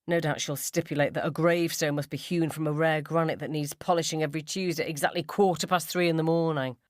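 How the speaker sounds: normal talking voice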